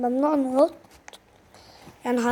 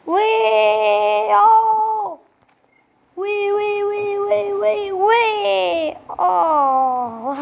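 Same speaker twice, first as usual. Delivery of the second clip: excited squeals; 5 semitones higher, much slower than usual. Real, not acted. Wild ride in the game.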